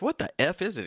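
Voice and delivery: high, puzzled exclamation; baffled. Retold or imitated. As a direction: squeak it out in confusion. Voicing own puzzled reaction.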